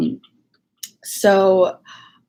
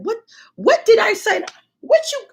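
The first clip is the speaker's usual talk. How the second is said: high whiny protest. Retold or imitated. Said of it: "I said".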